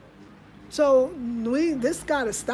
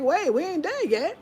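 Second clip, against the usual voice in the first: playful protest; 6 semitones higher than usual. Retold or imitated. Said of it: "we feel like".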